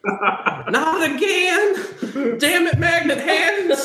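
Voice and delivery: high, laughing cry of despair; squealing laughing outrage; laughing exasperation. Acted. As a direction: crack up in exasperated disbelief. Clerk realizes it's him again.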